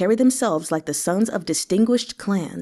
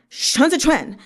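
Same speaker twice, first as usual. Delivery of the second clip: angry shout; 7 semitones higher, 3 dB louder than usual. A narrator's reading. Shouting a name in anger.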